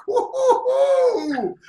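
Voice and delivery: high, slow laughing woo-hoo; delighted hoot, laughing; gleeful whoop. Retold or imitated. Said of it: "I was just like".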